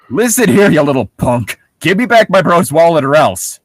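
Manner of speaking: put-on loud, menacing tough-kid threat; thug threat. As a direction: lean in and threaten him.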